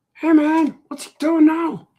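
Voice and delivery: high, loud mocking yell; critic yelling. The critic character heckles the drawing, high loud yell. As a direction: bark it out in a high nagging voice. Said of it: "he has to yell at me all the time".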